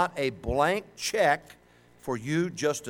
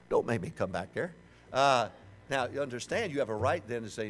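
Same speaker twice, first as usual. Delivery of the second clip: usual speaking voice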